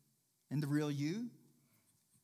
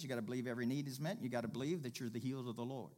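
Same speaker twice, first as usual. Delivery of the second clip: usual speaking voice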